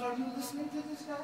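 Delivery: high, mocking aside to the crowd; a mocking king turning to his court; mocking pharaoh. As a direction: sneer to the onlookers. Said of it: "He goes".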